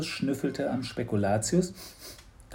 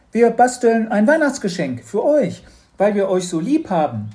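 put-on high, loud girl's declaration; calling out; 10 semitones higher, 9 dB louder than usual. A narrator's reading. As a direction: announce it brightly and loudly to the parents. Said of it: "rief Mathilda".

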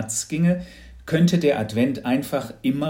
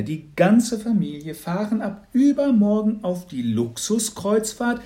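high, loud, over-the-top saleswoman voice; a disguised neighbour laying on the cheer; hammed-up gushing congratulations; gushing lottery lady; 8 semitones higher, 5 dB louder than usual. Announcing the cruise prize.

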